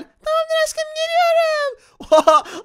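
put-on squeaky high-pitched spider girl, slow and sweet; girly high spider girl voice. Spider girl character answers boyfriend. (çok ince sesli örümcek kız)

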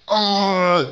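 sharp high yelp of dismay; frustrated yelp. Real, not acted. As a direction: let out a quick pained cry. Frustrated cry at bad pull.

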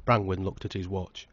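usual speaking voice

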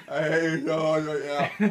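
loud laugh into the words. Real, not acted. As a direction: laugh through the line. Laughing at teasing.

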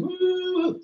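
cheering whoop for the crowd; excited whoop. Real, not acted. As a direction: let out a sudden joyful woo. Hypes up the healing session.

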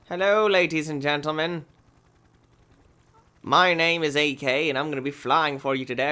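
ordinary talk, nothing marked

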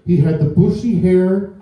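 ordinary talk

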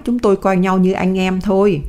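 plain reading voice

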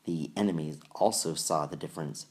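usual speaking voice

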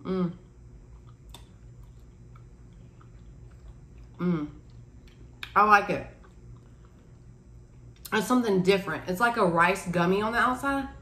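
talking between bites; chewing while talking. Real, not acted. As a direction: mouth full of mochi. Seen 1: Speaker holds bitten mochi, mouth closed and chewing between short remarks.

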